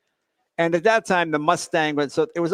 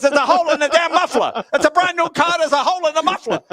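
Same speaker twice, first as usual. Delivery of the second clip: much higher, louder furious yell; outraged dad; 11 semitones higher, 6 dB louder than usual. Retold or imitated. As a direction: bellow like a dad cheated on a new car. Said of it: "He goes".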